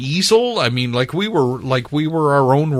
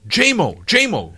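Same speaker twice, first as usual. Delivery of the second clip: higher, urgent call from the next room; a retold urgent summons; 11 semitones higher, 3 dB louder than usual. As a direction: shout for him to come quick. Colleague calling him in urgently.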